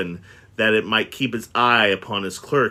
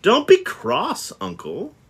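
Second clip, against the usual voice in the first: coaxing nephew; 9 semitones higher, slower than usual. Acted. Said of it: "said the nephew".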